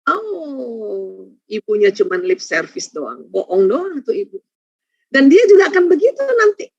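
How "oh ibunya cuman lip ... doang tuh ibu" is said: put-on low, soft, scoffing kid; a child shrugging off mom's apology; child's scoffing voice. Mother's talk, child mocking her apology, low and dismissive. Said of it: "maka anak-anak akan bilang".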